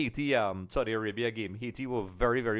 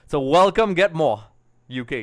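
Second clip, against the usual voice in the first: raised, louder shout-out; enthusiastic welcome; 4 semitones higher, 9 dB louder than usual. Real, not acted. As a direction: welcome a loyal fan loudly, pitched up. Welcomes the loyal supporter by name.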